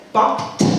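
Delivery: loud "bump", then a crisp separate "t"; punched "bump" with a released t. Shown as a demonstration. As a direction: sound out "bump t" with a sharp final t. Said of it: "when to pronounce as t".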